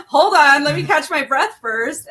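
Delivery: half-laughing at herself for being in tears; tearful, breathless. Retold or imitated. Mother recalls reading the card, retelling her tearful, breathless reaction. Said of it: "I was, like, already in tears".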